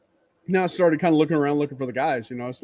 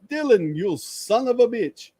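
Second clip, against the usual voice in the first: a hint of playful scolding, a little higher; mock insult greeting; 7 semitones higher than usual. Teasing Loki about his broken-down truck, playful mock insult. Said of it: "I did the".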